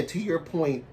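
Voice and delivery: ordinary delivery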